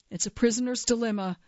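ordinary delivery, nothing marked

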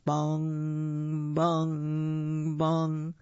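slow, even, ringing bongs; clock chime. Acted. Clock strikes midnight in story.

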